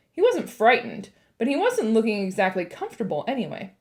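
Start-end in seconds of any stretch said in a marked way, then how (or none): none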